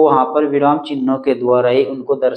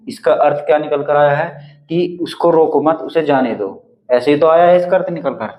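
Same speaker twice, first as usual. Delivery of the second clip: ordinary talk, nothing marked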